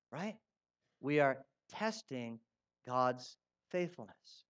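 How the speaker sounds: usual speaking voice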